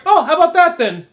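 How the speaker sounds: high, loud, breezy exclamation; mock unfazed remark. Kid after the mom jumps from the train, retold as unfazed. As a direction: imitate someone cheerfully untroubled. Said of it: "not even, like, fazed by it".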